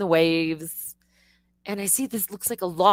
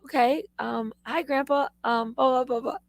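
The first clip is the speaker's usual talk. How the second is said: put-on timid teen self; 6 semitones higher than usual. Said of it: "I was like this little fourteen-year-old".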